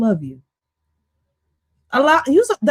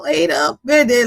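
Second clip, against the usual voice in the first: usual speaking voice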